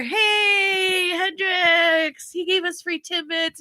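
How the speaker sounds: high, drawn-out call. Retold or imitated. Telling Hendrix about free treats, calling out. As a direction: call out cheerily to the kids.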